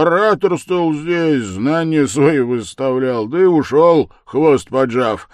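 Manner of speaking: put-on loud, high drunken tirade; boisterous drunken harangue; tipsy shouting. A narrator's reading. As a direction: bellow it out, stretching words in places. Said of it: "закричал хмельной Разумихин".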